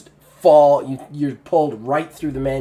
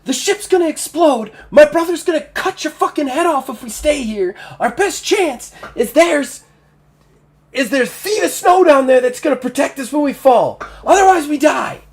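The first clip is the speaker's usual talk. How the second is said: high, loud pleading voice; urgent NPC woman; 12 semitones higher, 5 dB louder than usual. Acted. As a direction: urge him fast before it blows. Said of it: "she goes".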